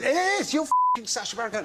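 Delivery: high-pitched insistent local; mock an overexcited fan, pitch shooting up; excited pub bloke. Pub bloke insisting on who he is, high excited imitation.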